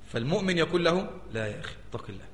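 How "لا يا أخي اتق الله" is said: quiet pious reply. Retold or imitated. Believer answers the tempter.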